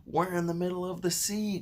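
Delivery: high, loud alarmed cry; cried out. A narrator's reading. James cries out to the bugs in alarm. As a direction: call out the shock. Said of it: "cried James".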